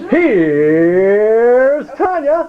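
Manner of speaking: long drawn-out, high, loud announcer intro; show announcer introduction. Pretend. Host introducing the next joke teller to the group, drawn-out announcer call. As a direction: stretch out the name like a show host.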